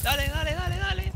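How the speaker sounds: urgent urging. Real, not acted. Urging teammate to go.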